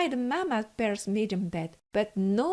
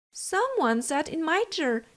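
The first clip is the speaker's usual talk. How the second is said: Mama Bear's average voice; 6 semitones higher than usual. A narrator's reading. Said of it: "in her average voice".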